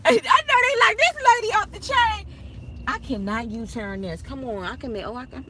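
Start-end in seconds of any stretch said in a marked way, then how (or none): 0.0-2.2 high, loud and laughing. Retold or imitated. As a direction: laugh through the imagined comment. Imagining attorneys' reaction, laughing.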